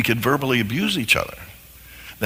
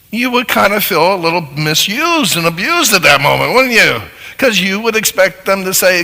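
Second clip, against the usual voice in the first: higher, louder pulpit preaching; full-force preaching; 7 semitones higher, 6 dB louder than usual. Acted. Preacher pressing the congregation on misuse, loud and emphatic. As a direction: drive it home from the pulpit.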